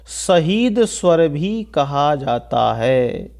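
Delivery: very slow, drawn-out words; slow dictation. Real, not acted. Teacher giving students a term for their notes, very slow dictation. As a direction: stretch it out word by word.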